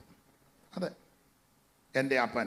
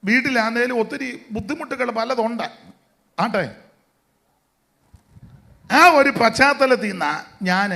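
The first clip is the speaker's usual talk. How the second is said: preaching at full force; 7 semitones higher, 10 dB louder than usual. Acted. Preaching climax about his parents' hardship.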